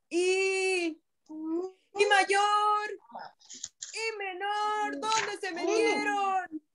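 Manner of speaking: drawn-out plaintive calling; searching little brother. A narrator's reading. Brother I looking for his missing brothers, slow plaintive calls. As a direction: call for lost brothers, stretching each phrase.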